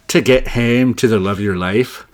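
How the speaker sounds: hammed-up Scottish accent, pushed loud; bad Scottish accent. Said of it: "That was a bad accent".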